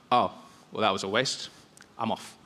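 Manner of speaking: low, soft, deflated giving-up; disheartened. Acted. Official giving up on Jesus and leaving, disheartened. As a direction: give up quietly, voice dropping away.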